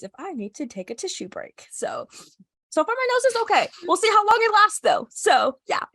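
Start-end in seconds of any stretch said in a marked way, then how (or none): none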